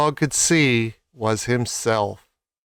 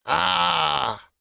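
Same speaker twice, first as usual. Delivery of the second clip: startled cry; 3 semitones higher than usual. Acted. Lion caught in net.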